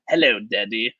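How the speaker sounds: light mock of a dubbed British voice; imitating the dubbed voice. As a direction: quote the line as the dub did it. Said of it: "the voice that comes out".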